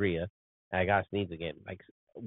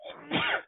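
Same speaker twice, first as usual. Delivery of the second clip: sneezing; 4 dB quieter than usual. Real, not acted. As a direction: let a real sneeze burst out. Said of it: "Excuse me, I'm gonna sneeze".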